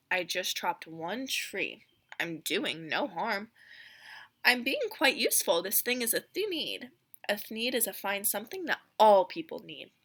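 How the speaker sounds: put-on lively read-aloud narration for children; storybook reading. A narrator's reading. Teacher reading The Lorax to her class, lively storybook voice. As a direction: read it like a picture book to a class. Reads Once-ler and Lorax dialogue.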